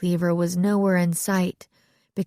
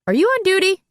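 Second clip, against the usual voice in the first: sharp, much higher and louder demand; curt; 14 semitones higher, 6 dB louder, faster than usual. A narrator's reading. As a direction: cut him off sharply. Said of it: "she asked curtly".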